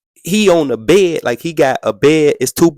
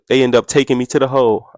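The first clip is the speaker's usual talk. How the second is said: usual speaking voice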